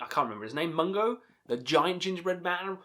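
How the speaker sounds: usual speaking voice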